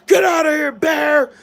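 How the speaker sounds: loud, high-pitched shout at a bear; screaming. Retold or imitated. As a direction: scare off the bear with a full yell. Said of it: "I'm screaming".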